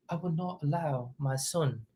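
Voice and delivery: a lightly attempted posh British accent, quiet and careful; proper Chris Eubank Senior. Vlogger imitating the boxer's proper posh British speech, softly. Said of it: "Can't even do the accent".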